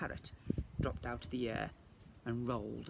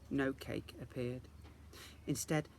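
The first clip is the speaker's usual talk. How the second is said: ordinary delivery, nothing marked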